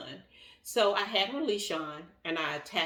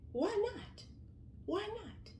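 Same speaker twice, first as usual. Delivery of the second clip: incredulous; 9 dB quieter, slower than usual. Real, not acted. Incredulous echo.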